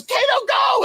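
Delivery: high-pitched shouted getaway order. Retold or imitated. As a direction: imitate a star squealing at his driver to take off. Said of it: "and he goes".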